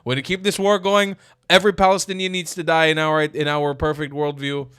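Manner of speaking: high, loud mocking fanatic; mock jihadist voice. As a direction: ham up a fanatic refusing. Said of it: "they're like".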